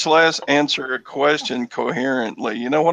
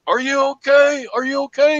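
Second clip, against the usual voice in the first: high mock-worried calling; mock concerned skiers; 12 semitones higher than usual. Skier mocking people who crowd round a fallen skier, high mock concern. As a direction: imitate the fussing skiers, much higher. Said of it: "everybody else around skis up and goes".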